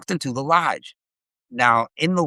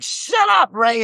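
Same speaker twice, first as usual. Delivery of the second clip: urgent shout; 12 semitones higher, slower than usual. Retold or imitated. Girlfriend yelling at Ray to stop, high urgent shout. Girlfriend hushing him during chase.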